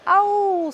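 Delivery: very high, loud yelp of pain; ouch. Acted. Paper plane bumps its nose on the door, high yelp. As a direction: yelp as you bump your nose.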